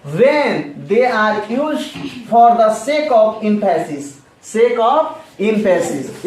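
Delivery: slow definition. Shown as a demonstration. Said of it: "teacher reads definition slowly".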